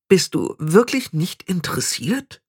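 high, slow, hesitant question; unsure. A narrator's reading. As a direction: ask uncertainly in a high girlish voice. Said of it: "fragte Anagramma unsicher". (unsicher und zögernd gefragt)

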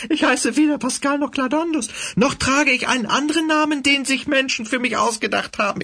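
high, sneering villainous cat. Acted. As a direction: taunt him like a fanatic cat leader. Villain cat revealing himself.

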